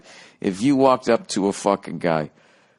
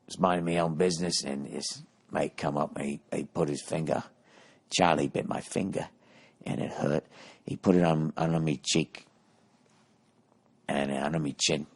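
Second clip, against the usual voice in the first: put-on English accent; 4 semitones lower, 8 dB quieter than usual. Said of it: "I don't know why I had to be English".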